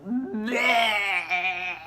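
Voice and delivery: retching "Bleh" with a gag; mock retching. Throwing up at the cafeteria table, hammed-up retch.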